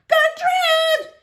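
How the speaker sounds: high, loud screeching bird; parrot squawk. A narrator's reading. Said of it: "she screamed again".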